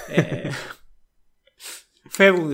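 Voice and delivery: ordinary delivery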